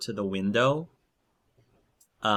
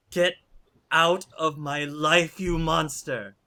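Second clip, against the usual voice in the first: high, raised-voice fury; angry banishing cry; 7 semitones higher, 3 dB louder than usual. Acted. Woman hurling a vase at the werewolf, angrily crying out. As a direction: throw the words out, pitched up.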